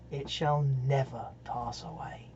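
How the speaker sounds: soft, fading almost to a whisper; hushed ending. Acted. Reader closing the garden poem for listeners, hushed and solemn. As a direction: let the closing line sink into a near-whisper.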